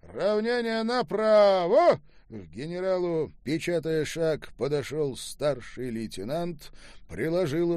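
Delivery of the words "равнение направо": high, loud drill-ground shout; parade command. A narrator's reading. Officer orders the company to attention, commanding shout. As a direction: bellow drawn-out military orders. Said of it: "раздалась команда".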